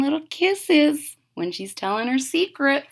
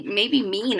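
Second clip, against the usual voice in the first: ordinary delivery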